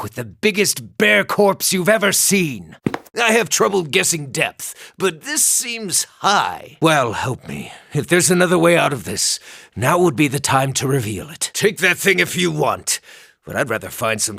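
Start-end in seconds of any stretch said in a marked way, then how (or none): none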